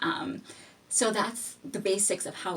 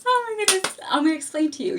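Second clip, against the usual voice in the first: exasperated outburst; 11 semitones higher, 7 dB louder than usual. Real, not acted. Exasperated aside about strict school.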